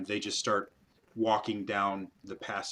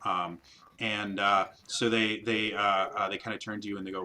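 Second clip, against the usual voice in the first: ordinary talk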